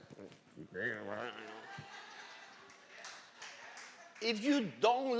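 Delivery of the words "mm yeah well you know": low, very soft mock mumble; uninterested mumble. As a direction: imitate a listless listener, barely audible. Imitating people sitting uninterested in church.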